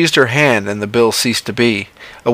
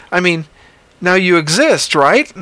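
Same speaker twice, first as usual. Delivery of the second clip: higher, hopeful reasoning; John's voice; 8 semitones higher than usual. Acted. John reasons with the creature, higher and hopeful. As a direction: coax her toward agreement.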